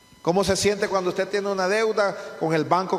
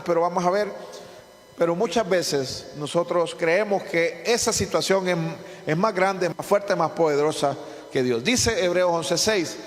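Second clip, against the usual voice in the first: ordinary talk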